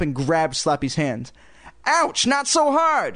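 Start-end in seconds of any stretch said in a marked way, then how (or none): 1.9-3.1 high, drawn-out dummy yelp; very high puppet complaint; Slappy crying out. A narrator's reading. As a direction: cry out slowly in a squeaky puppet voice. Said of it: "Slappy cried".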